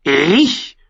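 hoch gekrächztes Nachäffen; krächzender Rabe. A narrator's reading. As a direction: ein Rabe plappert das Wort nach. Rabe äfft Sam nach. (der Rabe äfft Sam hoch krächzend nach)

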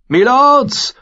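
high, loud call for attention; urgent shout. A narrator's reading. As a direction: cry out to the knights. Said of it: "riss sich los und drängte sich vor".

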